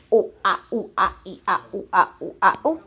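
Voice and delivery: low sing-song oh ah ooh chant; monkey noises. Shown as a demonstration. Parent modelling monkey sounds for toddler, low and playful. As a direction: hoot like a monkey to make the baby copy. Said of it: "Can you say".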